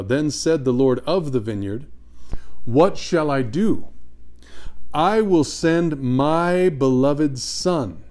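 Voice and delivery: slow, emphatic reading of a parable; solemn scripture reading. A narrator's reading. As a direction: declaim it with long, weighty words. Reads scripture aloud.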